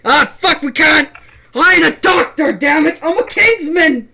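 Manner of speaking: loud, high, desperate yelling; desperate shouting. Acted. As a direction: shout it in helpless despair. Acted kingsman despairing.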